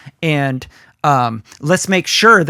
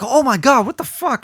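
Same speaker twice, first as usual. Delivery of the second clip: much higher, shocked gasp of disbelief; shocked viewer; 7 semitones higher than usual. Retold or imitated. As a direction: react like you just saw a phone burn. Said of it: "people are like".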